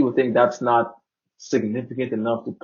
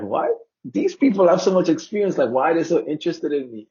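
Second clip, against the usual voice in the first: higher-pitched, incredulous questioning; retold puzzled astonishment; baffled disbelief; 6 semitones higher than usual. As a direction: act out your amazed reaction. Acts own disbelief at being followed.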